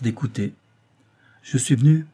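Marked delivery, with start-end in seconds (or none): 1.4-2.1 louder, higher, firm announcement; steady, unwavering voice. A narrator's reading. Doctor states his errand to his friend's wife, steady and unwavering. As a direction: say it without a tremor. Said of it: "d'une voix qui ne tremblait pas".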